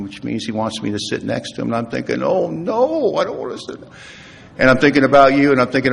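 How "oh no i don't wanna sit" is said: high, reluctant inner whine; mock dread. Son-in-law thinks about sitting by the patient, mock-whiny dread. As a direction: balk comically, pitch climbing high.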